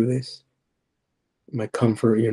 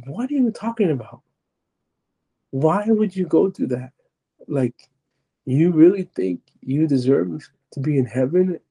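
high, disbelieving retold questions; incredulous challenge; 5 semitones higher than usual. Demonic voice questions his place in heaven, incredulous. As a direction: swoop up high on each challenge.

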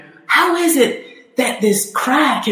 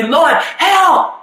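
rising, higher plea, nearly a shout; desperate cry for help; 8 semitones higher, much slower than usual. Pretend. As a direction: cry out to God for help, voice climbing. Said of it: "we can just say".

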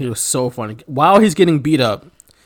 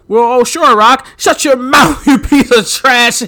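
Jerry Lawler impression; 6 semitones higher, 5 dB louder, slower than usual. Voicing Jerry Lawler retort.

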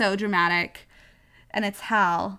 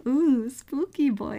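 mock spooky; 5 semitones higher, slower than usual. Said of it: "It's like".

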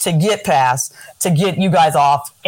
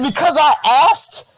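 high, indignant disbelief; reply in shocked disbelief, pitched up; incredulous retort; 9 semitones higher than usual. Retold or imitated. Retold reply to the insult.

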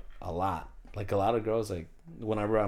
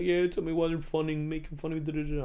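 higher-pitched imitation of an indignant caller; retold complaint; 8 semitones higher than usual. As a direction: rattle off a mock phone complaint, pitched up. Retelling her upset phone call.

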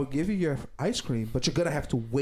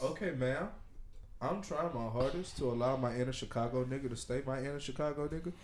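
quiet mock threat; 5 semitones lower, 8 dB quieter than usual. Acted reply to manager.

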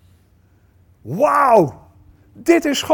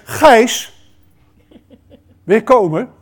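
calling by name; 4 semitones higher, 6 dB louder, slower than usual. Acted. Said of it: "Maar Jezus zegt".

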